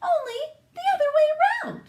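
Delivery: high, fast, squeaky wife voice; farmer's wife voice. A narrator's reading. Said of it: "she told her husband".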